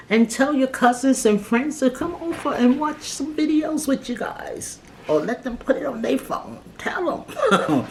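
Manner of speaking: high, giddy sign-off; excited. Real, not acted. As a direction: bubble up playfully. Playful sign-off, voice rising.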